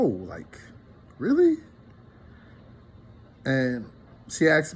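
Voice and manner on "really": surprised. Retold or imitated. Mock disbelief at hurtful remark.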